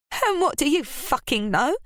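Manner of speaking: high, enraged retort; enraged Ruby. A narrator's reading. Ruby rounding on her cousin Scarlett in rage. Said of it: "enraged by the fact".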